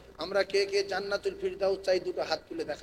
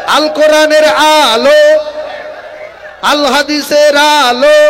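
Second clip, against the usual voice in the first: loud rising calls, longer and higher at the end; building rally shouts; 6 semitones higher, 19 dB louder, much slower than usual. Real, not acted. Preacher rousing the gathering to answer, shouting. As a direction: whip the crowd up to a roar.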